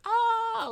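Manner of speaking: high, loud mock gasp-scream; imitating her shriek; shocked shriek. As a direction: squeal in mock horror. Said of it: "she was like".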